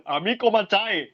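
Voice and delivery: high-pitched put-on catchphrase; hammed-up high greeting; mock character. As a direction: pipe it up as a silly character. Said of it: "I'll be like".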